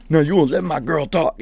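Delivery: hammed-up boyfriend yelling; mock angry yell. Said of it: "start yelling at the people".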